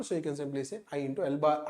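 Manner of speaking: ordinary talk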